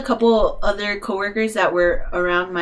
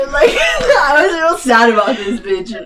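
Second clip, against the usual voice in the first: ordinary delivery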